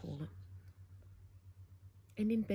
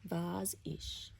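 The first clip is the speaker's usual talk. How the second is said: soft savoring; 3 semitones lower, 7 dB quieter than usual. Real, not acted. Host savors the word.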